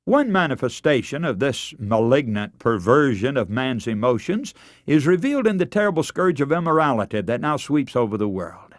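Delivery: normal talking voice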